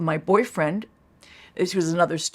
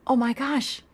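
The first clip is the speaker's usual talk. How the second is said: high, startled "Oh my gosh"; like a light bulb switching on; sudden realization; 8 semitones higher than usual. Retold or imitated.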